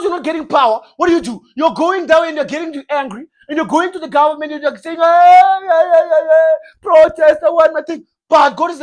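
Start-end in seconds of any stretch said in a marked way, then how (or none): 5.0-8.1 mocking protesters. Protesters demanding things from government.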